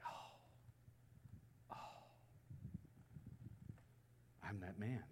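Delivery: very soft, hushed "oh", then a low admission; stunned realization. Retold or imitated. David realizes he is the guilty man, hushed realization. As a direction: let the guilt sink in, barely voiced.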